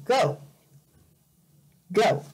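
ordinary talk

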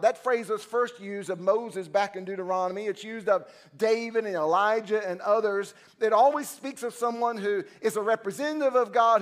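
ordinary delivery

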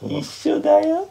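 slow, eerie high singsong; creepy high voice. Pretend. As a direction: sing it sweetly creepy. Creepy reply as a joke.

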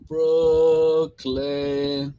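Brooklyn drawn way out on both syllables; long Brooklyn. Shown as a demonstration. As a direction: stretch Brooklyn like a concert shout-out. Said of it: "you give it that long".

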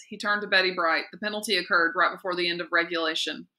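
ordinary delivery

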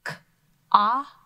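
K, ah with a loud isolated short o; short o sound. Shown as a demonstration. Teacher isolating the short o in cobweb. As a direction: punch the vowel ah after K. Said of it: "That's a short O".

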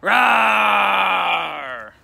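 put-on a loud, high little boy's growl. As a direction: growl to scare off the forest things. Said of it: "and then growl a warning".

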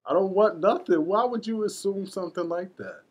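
hammed-up hurt protest; mock-offended denial. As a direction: play wounded innocent on the phone.

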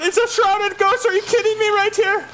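high, urgent yelling orders; panicked captain yelling at the crew; alarmed shouting. Real, not acted. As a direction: voice jumps up in alarm. Panicked at a ghost ship.

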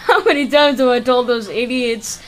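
laughing scold. Acted. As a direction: laugh then scold the idiots.

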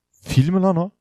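high, loud disbelieving cry; can't believe it's an elephant; real startled shout; amazed. Real, not acted. Sees an elephant mob.

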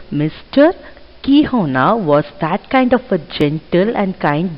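ordinary delivery

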